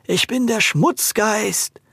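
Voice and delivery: hollow, slow ghost voice; empty suit of armor. Acted. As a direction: boom it out slowly like a hollow ghost. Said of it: "sprach er mit hohler Stimme".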